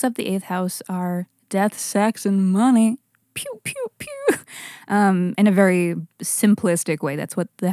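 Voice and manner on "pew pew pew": laser pew sounds. Acted. Mock laser sound effect.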